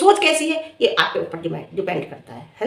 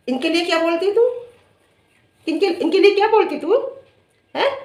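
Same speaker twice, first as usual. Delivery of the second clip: taunting challenge; 8 semitones higher than usual. Real, not acted. Challenging the rival to answer.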